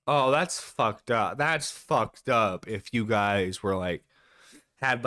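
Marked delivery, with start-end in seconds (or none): none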